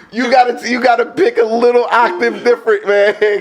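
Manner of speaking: high-pitched, laughing tease; real laughing. Real, not acted. Joking about his voice, laughing.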